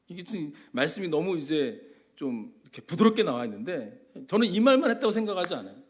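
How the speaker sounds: ordinary talk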